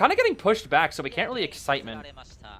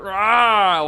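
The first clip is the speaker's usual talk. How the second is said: loud imitated roar; big drawn-out monster RAH; Goatman noise; 3 semitones higher, 10 dB louder than usual. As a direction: roar like Goatman taking a hit. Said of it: "or like".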